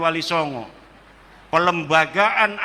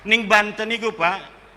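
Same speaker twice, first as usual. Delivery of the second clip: usual speaking voice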